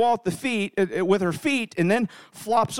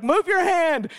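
retold urgent plea; 7 semitones higher, slower than usual. Panicked parent to crying child.

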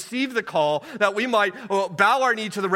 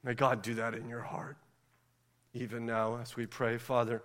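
much softer, lower prayer voice; 7 semitones lower, 9 dB quieter than usual. Acted. Pastor leading the congregation in prayer.

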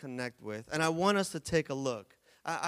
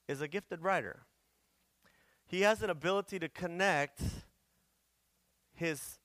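normal talking voice

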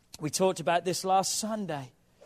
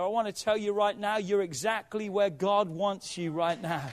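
normal talking voice

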